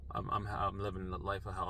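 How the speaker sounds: soft, drained and hopeless; weary. Retold or imitated. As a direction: answer like you've given up. Exhausted answer after sigh.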